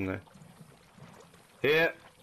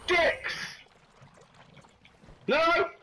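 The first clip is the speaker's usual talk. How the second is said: short, high-pitched outbursts; frustrated yell. Real, not acted. Yelling at game enemies.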